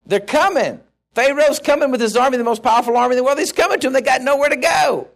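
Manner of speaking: preacher's rising alarm; urgent rising alarm. Real, not acted. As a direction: sound the warning as the army comes. Building tension of Pharaoh's approach.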